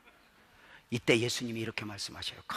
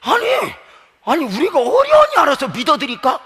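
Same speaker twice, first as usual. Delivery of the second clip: high, loud, hammed-up indignation; exasperated protest; 17 dB louder than usual. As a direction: stretch 어련히 out as a loud protest. 같은 말을 과장해 항변. (답답하다는 듯 크게 항변)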